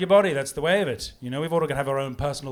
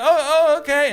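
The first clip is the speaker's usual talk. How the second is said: very high, loud, stammered mock panic; flustered answer; 11 semitones higher, 9 dB louder than usual. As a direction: blurt it out, caught totally off guard. His own flustered answer, retold.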